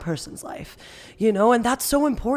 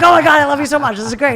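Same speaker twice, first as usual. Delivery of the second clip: loud, higher, thrilled outburst; acted-out adoring excitement; gushing delight; 5 semitones higher, 10 dB louder, faster than usual. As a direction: gush as if won over completely. Acted delighted response of others.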